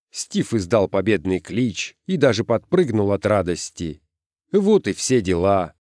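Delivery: ordinary delivery, nothing marked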